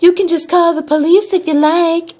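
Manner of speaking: high-pitched mock country lady; country accent yell. Tow lady dares customer to call police, yelling country accent. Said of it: "yelling at me in her little accent".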